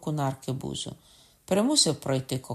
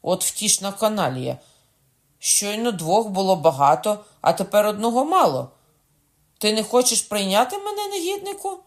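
indignant nobleman; 7 semitones higher, 6 dB louder than usual. A narrator's reading. Coconnas rages at the innkeeper.

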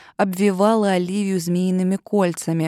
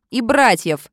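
loud threat; 3 semitones higher, 6 dB louder, slower than usual. A narrator's reading. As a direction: tack it on with a shout. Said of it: "добавила Оливия". (громкая добавка-угроза)

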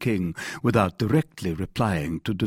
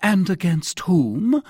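mild, higher-pitched exclamation, drawn out; crying out; 11 semitones higher, 6 dB louder, slower than usual. A narrator's reading. King questioning his captain, a touch of outcry. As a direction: demand it slowly in a raised pitch. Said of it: "cried the king".

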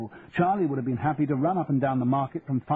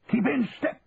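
shouted drill order; 12 semitones higher than usual. Acted. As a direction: chivvy the marching lads. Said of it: "Charlie had shouted at him".

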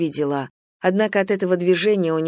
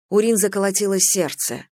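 ordinary delivery

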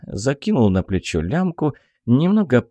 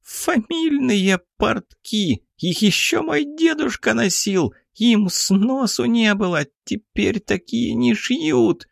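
very high, slow wailing pixie voice; a tiny fairy lamenting ruined trousers; 13 semitones higher than usual. Acted. Pixie groans over burnt trousers.

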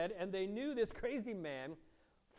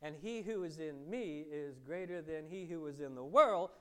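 ordinary talk, nothing marked

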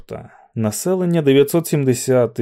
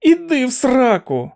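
shouted curse; 13 semitones higher than usual. A narrator's reading. Olson shouts a curse at McVries. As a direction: fling Іди в сраку back at him. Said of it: "закричав Олсон".

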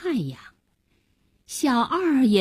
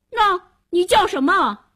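put-on high, louder child voice; questioning boy; 9 semitones higher, 4 dB louder than usual. Boy asks the drowning man's name, high questioning child. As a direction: ask pointedly as a young boy.

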